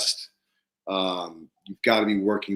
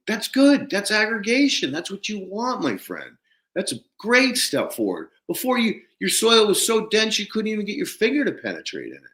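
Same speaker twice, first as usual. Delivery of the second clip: much higher, thrilled encouragement; excited; 12 semitones higher than usual. Retold or imitated. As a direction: celebrate the news with him. Excited reply to farmer's clods.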